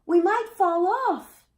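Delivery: high, loud, slow wail; crying out in fear. A narrator's reading. Frightened children tell citizens they might fall, high loud cry. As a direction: cry it out together, scared. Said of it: "they all cried together".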